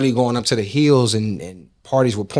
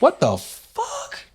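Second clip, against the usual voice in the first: high-pitched, drawn-out disbelief; retold shock; much slower than usual. Son hearing by voicemail that his dad died, stunned exclamation. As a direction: stretch it out slowly, pitch leaping way up in shock. Reacting to voicemail of dad's death.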